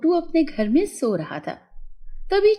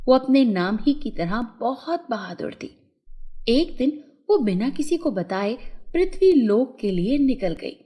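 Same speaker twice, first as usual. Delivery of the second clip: ordinary delivery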